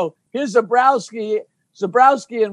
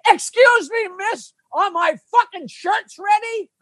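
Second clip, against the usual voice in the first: much higher-pitched, drawn-out mock demand; 11 semitones higher than usual. Ventriloquist's dummy rudely accosts a woman in the front row, high mock demand. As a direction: pitch it way up like a dummy heckling someone. Acts out ventriloquist heckling audience member.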